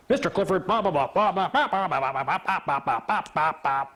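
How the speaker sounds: rattled-off blah-blahs; mock lecture dissolving into babble; mock scolding rant. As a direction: imitate a judge droning on in protest. Retelling the judge's scolding rant.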